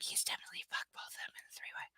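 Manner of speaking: fast, very soft whisper; hushed, hurried aside; whispered snide aside. Real, not acted. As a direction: mutter it quickly under your breath. Reader's snide aside on the book.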